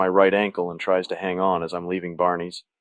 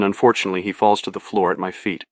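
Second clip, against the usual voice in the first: ordinary delivery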